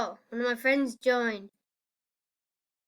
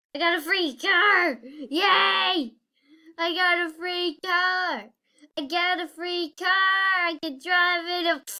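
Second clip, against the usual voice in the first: high, loud delighted cheering; gleeful and pleased with yourself; excited; 6 semitones higher, 5 dB louder than usual. Real, not acted. Excited about free car.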